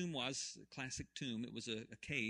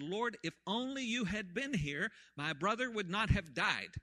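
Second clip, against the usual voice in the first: anguished plea; 8 semitones higher, 6 dB louder than usual. Acted. Mary pleading at Jesus's feet.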